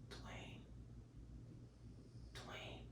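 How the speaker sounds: a ghostly whisper calling his name; eerie whisper. Retold or imitated. A haunting voice whispers the boy's name in the dark. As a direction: breathe the name, barely audible.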